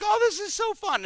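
very high, gushing mock glee; delighted. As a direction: squeal it like someone having a blast. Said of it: "people that think that's hilarious".

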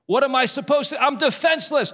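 high, distressed mock plea. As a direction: plead like someone with no defense. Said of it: "they don't know what to do".

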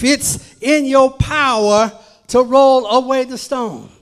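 ordinary talk

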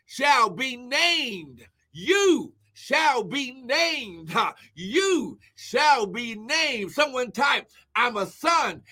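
slow, drawn-out preacher's proclamation; emphatic sermon cadence with whooped praise; fervent preaching. Real, not acted. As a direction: stretch each word out for the congregation.

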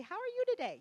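high sing-song how are you today; bright greeting. Shown as a demonstration. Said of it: "You say".

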